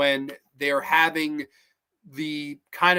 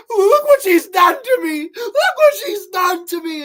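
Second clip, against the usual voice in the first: much higher, louder screaming panic; mock screaming victim; 9 dB louder than usual. Mutilated man screaming at his buddies in panic. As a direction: shriek it like he's bleeding out.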